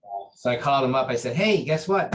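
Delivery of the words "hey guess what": high, loud eager call; bursting to share good news; excited news. Retold or imitated. Calling grandmaster with pregnancy news, excited and loud.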